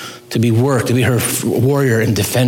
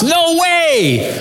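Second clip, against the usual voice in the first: excited high shout, much higher; retold shout of joy. Pastor recalling his outburst alone in his office, high excited shout. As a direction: burst out with amazed joy, retelling it. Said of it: "I remember shouting out loud in my office".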